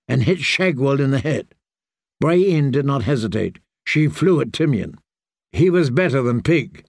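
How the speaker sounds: ordinary delivery, nothing marked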